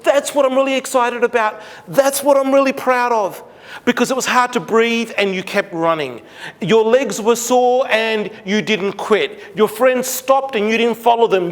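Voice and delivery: beaming praise to a daughter; excited praise. Retold or imitated. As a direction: lift her up with your voice. Dad twirls daughter, praising.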